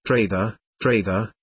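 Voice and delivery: a lightly voiced old hermit protesting; gently protest, old man; mildly remonstrating hermit; mild expostulation. A narrator's reading. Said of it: "expostulated the hermit mildly".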